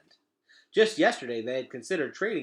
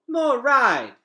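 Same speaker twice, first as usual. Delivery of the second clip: put-on much higher and louder, a kid begging for more; toddler pleading; 13 semitones higher, 12 dB louder than usual. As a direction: whine for another ride like a toddler. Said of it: "pleaded Boots".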